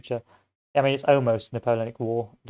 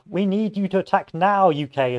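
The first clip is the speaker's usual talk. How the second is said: higher, pushy demand to attack; French ally insisting the British attack now, higher; urgent French plea; 6 semitones higher than usual. Retold or imitated. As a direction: press the British hard, pitched up. Voicing French urging attack.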